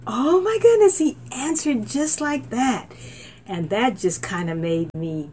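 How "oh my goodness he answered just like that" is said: high, loud cry of delight; high excited oh my goodness; thrilled exclamation. Retold or imitated. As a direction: squeal it out in amazement. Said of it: "So I was like".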